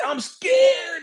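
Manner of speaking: high, frightened cry. Acted. Abandoned one crying out to family within earshot, high scared cry. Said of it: "they hear you crying".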